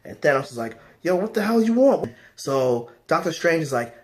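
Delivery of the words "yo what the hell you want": high-pitched, irritated challenge; mock pissed. Thanos fed up with unimpressed Nebula, mock pissed. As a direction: demand an answer in mild exasperation. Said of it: "like, pissed".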